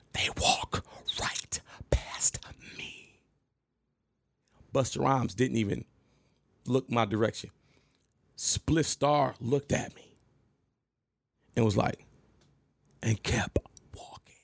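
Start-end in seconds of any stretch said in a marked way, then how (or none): 0.1-3.2 slow, hushed whisper; stunned whisper. Retold or imitated. Retelling how they walked past him, slow stunned whisper. 13.0-14.3 hushed whisper. Retold or imitated. [name] ignores him.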